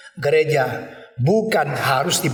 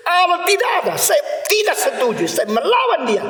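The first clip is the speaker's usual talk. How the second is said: defiant atheist; 13 semitones higher than usual. Retold or imitated. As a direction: proclaim defiance mockingly. Preacher mimics defiant atheist again.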